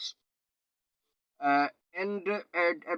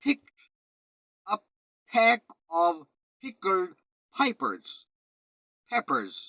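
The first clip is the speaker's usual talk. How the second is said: p turned aspirated ph in "picked", "pack", "pickled", "peppers"; 6 semitones higher, 3 dB louder, much slower than usual. Shown as a demonstration. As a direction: puff of air on every p. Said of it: "जहां जहां प था मैंने उसको फ कर दिया".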